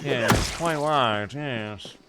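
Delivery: slow, put-on British; British accent. Shown as a demonstration. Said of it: "that was not fucking British".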